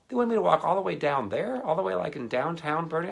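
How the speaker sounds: high, loud comic outrage; high incredulous. Retold or imitated. Complaining to the mom about the walk, high mock outrage. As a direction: whine it in mock disbelief.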